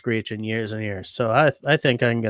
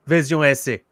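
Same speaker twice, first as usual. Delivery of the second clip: put-on lighter French accent; 3 semitones higher than usual. S0 trying the French accent once more. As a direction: repeat Vision S A with a mild French accent. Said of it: "You gotta get the accent down".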